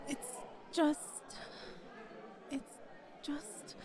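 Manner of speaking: flustered whisper. Acted. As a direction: breathe the words out, embarrassed. Flustered, can't finish the sentence.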